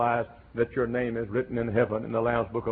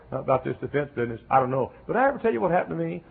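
fast, tumbling answer; eager Paul; 5 semitones higher, faster than usual. Retold or imitated. Preacher voices Paul hurrying into his testimony, fast. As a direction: rush past the question to the story. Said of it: "Paul said".

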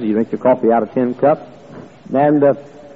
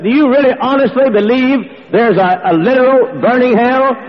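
higher, louder disbelieving question; incredulous reporter; 12 semitones higher, 3 dB louder than usual. Retold or imitated. Newspaper reporter questioning the preacher, higher and incredulous. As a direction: ask it like a stunned reporter.

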